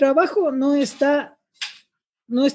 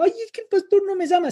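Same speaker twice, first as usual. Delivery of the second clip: higher, fast, whining church member; mock whiny complaint; 7 semitones higher, faster than usual. Pastor mocks a sulking member who feels ignored, whiny. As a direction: whine it like a hurt parishioner, mockingly. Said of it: "Uno que no está llorando".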